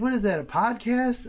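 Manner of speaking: high, loud scoffing wife; wife sneering at his stream; mock wife voice. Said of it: "She's like".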